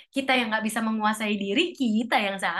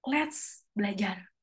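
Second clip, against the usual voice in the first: ordinary talk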